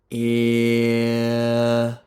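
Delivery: long, steady held "eh"; sustained vowel. Shown as a demonstration. Voice coach demonstrating the sound wave, sustained "eh". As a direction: stretch "eh" out on one pitch.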